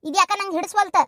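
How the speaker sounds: ordinary talk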